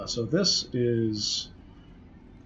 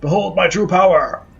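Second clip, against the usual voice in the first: big dramatic proclamation, higher, louder; booming villain shout; 6 semitones higher, 7 dB louder than usual. Acted. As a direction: roar it out like a villain. Villain's climactic boast.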